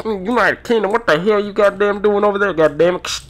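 high, crackly CB voice; mock-outraged trucker on CB; incredulous radio dispatcher. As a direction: balk over the radio in disbelief. Acted dispatcher reacts in disbelief.

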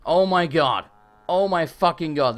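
loud exasperated cry of disbelief; loud stunned oh my god; shocked outburst. Real, not acted. Shocked by the unconfirmed sale.

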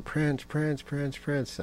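high, soft imitation of screaming fans; mock crowd screaming. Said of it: "you got this big crowd screaming".

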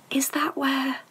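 hushed, breathless question; soft worried whisper over the sleeping baby. A narrator's reading. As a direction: whisper it so nobody hears. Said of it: "whispered M-, Professor McGonagall".

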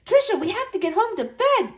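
high eager crow. A narrator's reading. Boy urging his sister home, high crow. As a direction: urge her, bursting. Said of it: "Richie crowed".

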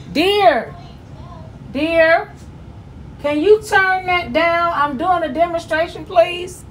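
sweetly insistent, drawn-out request; calling to husband. Real, not acted. Woman asking husband to turn that down mid-demonstration, calling out.

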